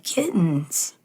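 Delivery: a menacing mad scientist; evil scientist. Retold or imitated. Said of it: "And the scientist is like".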